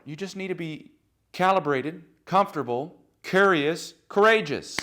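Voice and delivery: rising rallying cry; building to a shout. Real, not acted. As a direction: ramp the list up to a passionate roar. Climactic list builds to a shout.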